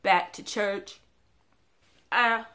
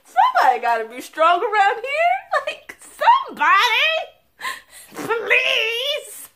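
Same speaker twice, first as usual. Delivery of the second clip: loud, high, exasperated; exasperated plea; 13 semitones higher, 8 dB louder than usual. Retold or imitated. To her crying family, a loud exasperated plea. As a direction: throw up your hands, pleading. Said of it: "It's like".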